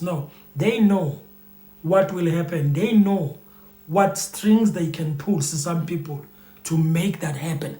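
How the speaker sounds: ordinary talk, nothing marked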